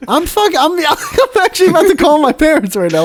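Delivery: high voice cracking into laughter; worked up and laughing; laughing indignation. Real, not acted. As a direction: protest through a real laugh. Mock outrage breaking into laughter.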